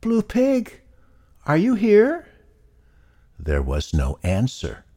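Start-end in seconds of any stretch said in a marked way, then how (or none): 0.0-2.3 high little piglet calling. Acted. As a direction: call out in a small high voice. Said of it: "called out".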